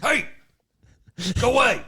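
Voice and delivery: loud high yell to scare off intruders; shouting at trespasser. Pretend. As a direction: chase a prowler off the yard. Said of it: "yell at 'em".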